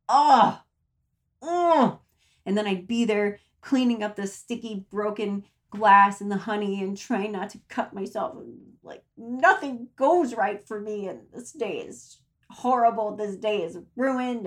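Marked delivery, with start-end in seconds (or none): 0.1-2.0 loud exasperated ugh; disgusted groan. Retold or imitated. Disgust at broken honey jar. 8.4-14.5 lightly mocking gloomy inner voice; a hint of self-pitying whine; spiral voice. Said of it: "That's my voice".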